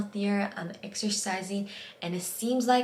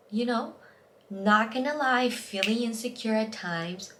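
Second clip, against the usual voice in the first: shaky voice; 3 semitones higher than usual. Real, not acted. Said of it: "did you hear my vo-, voice shaking there".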